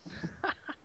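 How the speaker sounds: kind gentle laugh. Real, not acted. Soft laugh after her remark.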